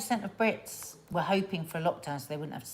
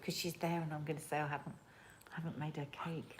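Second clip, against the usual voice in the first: whispering; 12 dB quieter than usual. Real, not acted. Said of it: "Why are you whispering so quietly?".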